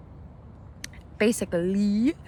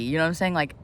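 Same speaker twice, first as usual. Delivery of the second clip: ordinary delivery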